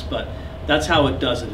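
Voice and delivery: usual speaking voice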